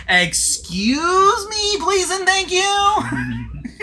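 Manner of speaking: high, slow, drawn-out mock-polite voice; imitating a TV character's catchphrase. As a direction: stretch it out like a prissy sitcom girl. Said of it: "there's this one girl in the show that constantly had the same line".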